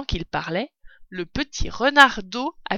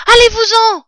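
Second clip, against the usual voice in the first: high, loud rat snapping an order; shrill little rat voice, sharp and loud; curt rat; 14 dB louder than usual. A narrator's reading. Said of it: "fit-il sèchement". (rat sec, voix aiguë et forte)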